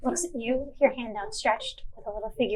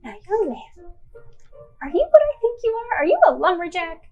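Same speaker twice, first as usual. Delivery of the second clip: put-on excited old carpenter; 6 semitones higher, 4 dB louder than usual. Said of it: "and Sal is like".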